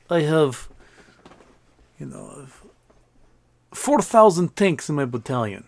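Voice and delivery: lightly voiced boastful general; a hint of a bragging Russian officer. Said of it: "trying to brag a little bit".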